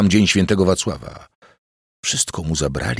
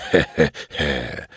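mocking laugh; slower than usual. A narrator's reading. Blind man laughs through the hand over his mouth. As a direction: drag out a nasty little laugh. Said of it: "obmierzły śmiech".

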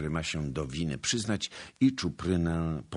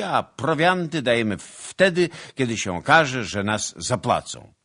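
loud, higher-pitched, forceful declaiming; blustering soldiers; 6 semitones higher, 10 dB louder than usual. A narrator's reading. Soldiers refusing townsmen's orders over quarters, loud bluster. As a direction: lay down terms at full volume.

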